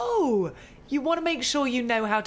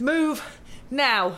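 sharp order; 4 dB louder than usual. Acted. Press officer orders manager out.

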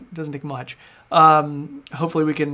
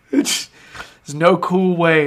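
ordinary talk, nothing marked

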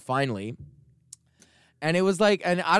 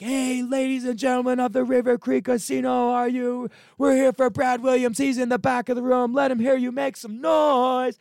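much higher, big hype-man announcer call; Brad Williams hyping crowd; 12 semitones higher, 3 dB louder than usual. Retold or imitated. Comedian bringing the opener on stage, booming hype announcer. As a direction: whip the casino crowd up like an emcee. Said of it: "like, a four-part applause break".

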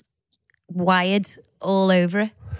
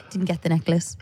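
whisper; 3 semitones lower, 3 dB quieter than usual. Real, not acted. Punchline, disappointment.

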